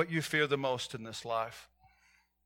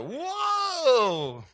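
much higher mock startled whoa; imitated shocked exclamation; 10 semitones higher, 3 dB louder than usual. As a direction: jump back in fright. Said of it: "This is like".

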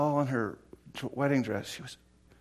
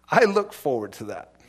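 ordinary delivery

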